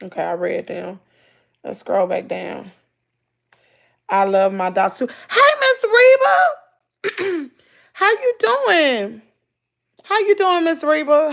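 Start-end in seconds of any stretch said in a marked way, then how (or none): 5.1-11.3 excited greeting. Real, not acted. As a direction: call out high to a friend you spot, stretching it. Greets a viewer by name, calling out.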